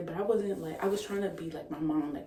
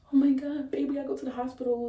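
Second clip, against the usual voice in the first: panicked; 8 semitones higher, 6 dB louder than usual. Retold or imitated. Retelling her panic to boyfriend.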